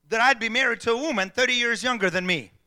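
ordinary talk

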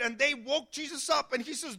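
ordinary talk, nothing marked

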